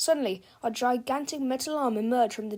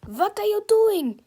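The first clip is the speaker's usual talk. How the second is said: outraged yell; 9 semitones higher, 9 dB louder than usual. Acted. Villain screaming in outrage.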